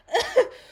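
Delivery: high, loud laugh on its own; can't hold the laugh in; burst of laughter. Real, not acted. Laughs at herself.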